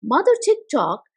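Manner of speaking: ordinary delivery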